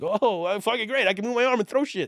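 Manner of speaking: very high, thrilled rush of delight; overjoyed. Retold or imitated. Telling the host about his healed arm, high and thrilled. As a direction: sell the excitement, voice way up.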